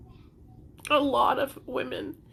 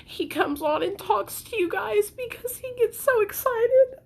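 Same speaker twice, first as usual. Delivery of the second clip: tearful, higher-pitched voice; crying; 5 semitones higher than usual. Real, not acted. Upset mother defending herself to viewers, crying. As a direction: talk through real tears. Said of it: "The reason why I'm crying".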